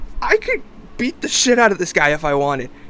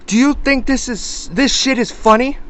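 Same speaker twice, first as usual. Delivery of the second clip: angry confrontation; 6 semitones higher, 3 dB louder than usual. Retold or imitated. Acted confrontation, in his face.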